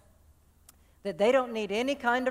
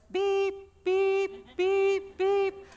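truck reversing beep; 9 semitones higher, much slower than usual. Pretend. Truck backing up to unload.

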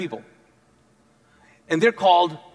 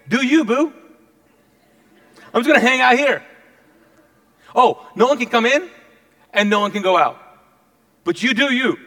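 high, loud, sassy mocking king; playful taunt; 5 semitones higher, 6 dB louder than usual. King to the city he has surrounded, playful taunt. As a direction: taunt them breezily, pitched up.

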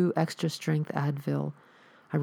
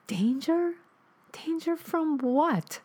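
high, drawn-out mocking disbelief; scoffing; 12 semitones higher, slower than usual. Noah scoffs at his dead mother's warning, high and slow. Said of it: "I scoffed".

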